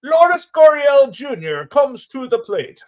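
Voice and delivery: put-on slow, high old-time radio call; 1940s announcer. Streamer calling the batter in a 1940s announcer voice. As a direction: call it like a vintage radio man. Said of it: "good nineteen forties announcer".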